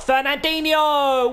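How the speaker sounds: high, loud, stretched-out scorer's name; long goal yell. Real, not acted. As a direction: drag the name out like a commentator.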